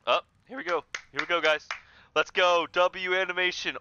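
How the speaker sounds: high and loud hype; hyped. Real, not acted. Streamer hyped for the cutscene, loud excited cheer.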